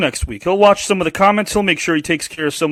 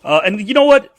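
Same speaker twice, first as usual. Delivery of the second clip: ordinary delivery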